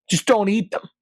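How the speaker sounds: real exasperation, up high; exasperated outburst. Real, not acted. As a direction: vent at the picky customer.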